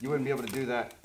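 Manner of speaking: muffled behind full face mask; speaking inside respirator; talking through gas mask. Real, not acted. Seen 4: Both men wear full gas masks; the speaker is unclear.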